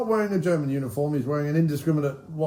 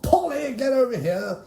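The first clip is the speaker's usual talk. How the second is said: much higher, exasperated hotelier call; Basil Fawlty impression; 9 semitones higher, slower than usual. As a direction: shout for staff like a flustered Basil Fawlty. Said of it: "basically playing Basil Fawlty".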